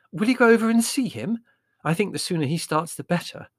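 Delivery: high-pitched, eager motherly request; delighted. Acted. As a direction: urge him on happily, pitched well up. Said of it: "said Mother delightedly".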